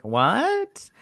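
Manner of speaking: high rising mock what; mock disbelief. Reacting to the princess's plan, mock disbelief. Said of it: "I'm all like".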